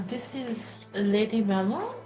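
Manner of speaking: ordinary talk, nothing marked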